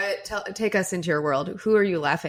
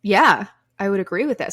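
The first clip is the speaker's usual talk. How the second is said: loud, emphatic agreement; aggressively loud; 8 dB louder than usual. Real, not acted. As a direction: blurt it out loud. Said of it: "Sorry, that was aggressively loud".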